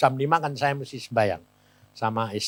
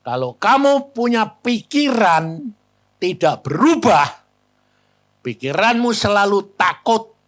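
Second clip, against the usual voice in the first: loud, raised, emphatic warning; scolding; 4 semitones higher, 7 dB louder than usual. Retold or imitated. Preacher warns the doctor about his fearful thinking, scolding. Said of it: "malah marahin dia".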